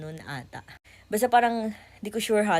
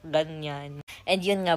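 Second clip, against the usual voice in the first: normal talking voice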